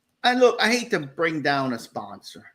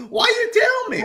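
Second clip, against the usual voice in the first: very high, loud mock complaint; exasperated protest; 5 dB louder than usual. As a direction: cry out like a let-down customer. Acting out frustrated customer's protest.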